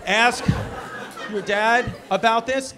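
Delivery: loud, slow call-out to the daughter; shouting advice. Acted. Comic shouting it to the daughter in the crowd. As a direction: ask your dad, almost yelling it. Telling kid to ask dad.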